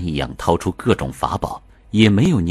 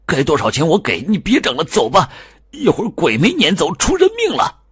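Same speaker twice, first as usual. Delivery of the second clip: tearful pleading; 11 semitones higher than usual. Acted. Husband begs Liu to stop, frantic and tearful. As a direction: beg him to stop, voice breaking. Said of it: "带着哭腔说".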